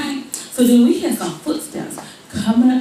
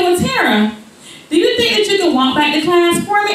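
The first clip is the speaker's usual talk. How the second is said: high, quick sweet teacher; lightly voiced gentle teacher; 8 semitones higher than usual. Teacher asking Tara to walk back, high.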